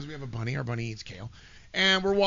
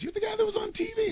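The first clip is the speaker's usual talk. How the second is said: much higher, starstruck stranger; high fan voice. Retold or imitated. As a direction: squeak it like an awestruck fan. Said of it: "And he goes".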